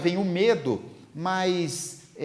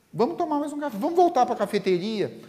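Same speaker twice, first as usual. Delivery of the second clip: high, eager coaxing voice; pleading friend; 9 semitones higher than usual. Acted. Friend begging Divaldo to stay for coffee, high and coaxing. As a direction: coax him into one more coffee, much higher.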